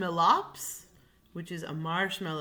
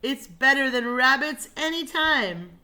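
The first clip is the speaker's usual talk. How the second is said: raving lion; 7 semitones higher, 9 dB louder than usual. Acted. Lion raves.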